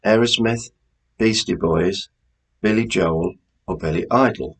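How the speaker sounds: usual speaking voice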